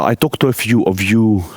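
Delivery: normal talking voice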